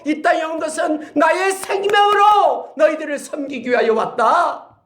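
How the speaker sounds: high, loud impassioned proclamation; Jesus teaching his disciples to serve, high and loud; voicing Jesus. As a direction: proclaim it as Jesus, rising to a shout. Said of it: "라고 예수님께서 그들을 가르쳐 주는".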